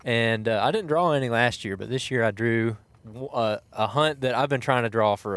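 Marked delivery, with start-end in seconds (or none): none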